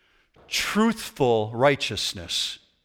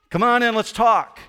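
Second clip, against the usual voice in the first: friendly welcome; 7 semitones higher, 5 dB louder than usual. Retold or imitated. Welcoming sinners, fist bump.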